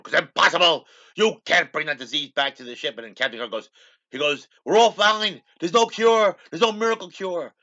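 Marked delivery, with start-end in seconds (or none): 0.0-3.0 high, loud objection; imitating an outraged doctor; emphatic protest. McCoy protests to Kirk. 4.6-7.5 high, loud retold argument; imitating an exasperated captain arguing; heated insistence. Kirk argues back urgently.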